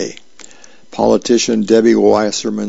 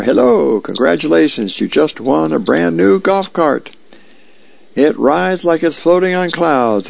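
higher, chirpy sales pitch voice; phone salesman; 6 semitones higher than usual. A narrator's reading. Caller on the phone pitches a prize to Dagwood, chirpy salesman voice. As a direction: sell it like an eager telemarketer.

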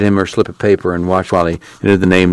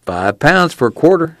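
put-on a slightly higher, glib young clerk; lightly voiced store clerk; 9 semitones higher than usual. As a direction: sell it to her, a bit smug. Clerk voiced in read story.

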